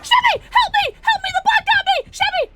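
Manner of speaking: very high, loud screams; desperate high scream; screaming for help. Real, not acted. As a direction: shriek for your teammate to save you.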